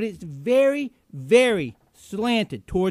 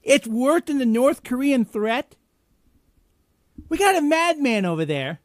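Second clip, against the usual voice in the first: incredulous outburst; 6 semitones higher, 5 dB louder than usual. Real, not acted. Outraged reaction to clip.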